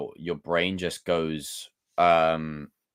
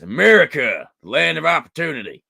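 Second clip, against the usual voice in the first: loud, higher hammed-up American accent; 6 semitones higher, 8 dB louder than usual. Shown as a demonstration. Streamer does American accents on request, hammed-up American. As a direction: proclaim it in a put-on American accent. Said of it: "do more American accents".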